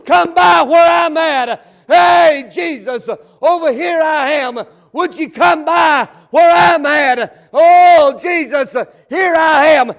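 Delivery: loud, drawn-out cry for help, surging on the first words; calling out to Jesus. Acted. Blind Bartimaeus hollering from the roadside to passing Jesus, loud pleading call. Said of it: "And as he heard Jesus was coming, He said".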